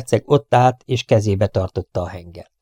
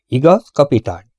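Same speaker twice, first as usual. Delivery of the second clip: higher, louder, sharp demand; sharp and hard; 5 semitones higher, 5 dB louder than usual. A narrator's reading. Prince challenging the captain, sharp and hard. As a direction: expect to be contradicted. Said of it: "Éles és kemény hangon kérdezte ezt".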